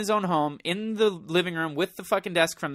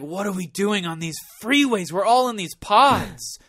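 louder, slower stoned amazement; stoned awe; 5 semitones higher, 4 dB louder than usual. Retold or imitated. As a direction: rant in stoned wonder. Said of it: "And I'm just like".

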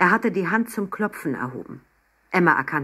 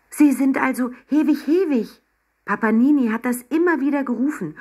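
high, louder, eager exclamation; excited realisation; 8 semitones higher, 4 dB louder than usual. A narrator's reading. Girl realises who the penguin kept calling for, excited. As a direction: burst out with the discovery.

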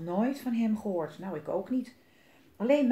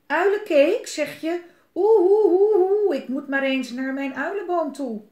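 high, loud alarmed owl with hoots; startled owl hooting; 8 semitones higher, 10 dB louder than usual. Acted. Owl, frightened by the mouse's tale, hoots loudly and flees. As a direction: hoot in panic and fly off.

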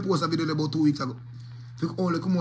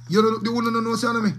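higher, louder put-on voice; talking like this; 6 semitones higher, 3 dB louder than usual. Shown as a demonstration. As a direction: show how you talk now, pitched up. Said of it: "and now talk like this".

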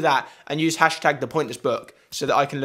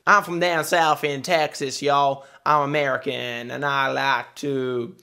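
put-on Texan American accent. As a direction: do a broad Texas accent. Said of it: "What kind of American am I gonna do?".

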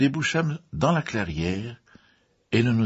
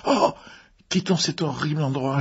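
high cry turning to wail; frightened moan; 8 semitones higher than usual. A narrator's reading. Mrs Rogers begs to leave the grove, frightened moan. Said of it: "gémit-elle".

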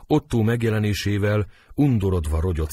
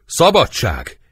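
high, loud cry for freedom; dramatic outcry; shouted cry; 8 semitones higher, 8 dB louder, slower than usual. A narrator's reading. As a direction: shout it out with force. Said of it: "Otto kiáltva".

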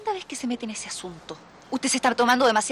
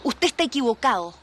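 ordinary talk

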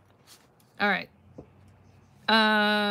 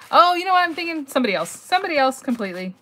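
loud, high burst of realizing; loud surprised oh of remembering; sudden realization; 7 semitones higher, 9 dB louder than usual. Real, not acted. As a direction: catch your own mistake out loud. Sudden realization of her mix-up.

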